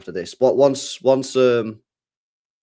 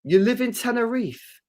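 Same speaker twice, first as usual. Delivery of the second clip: pitched up in disbelief; surprised question; 10 semitones higher than usual. Real, not acted. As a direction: react with wide-eyed surprise. Surprised reading chat.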